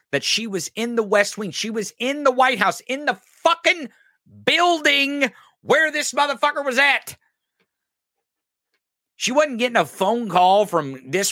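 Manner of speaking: escalating incredulous rant; rising emphatic rant. Real, not acted. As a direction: build to an incredulous peak.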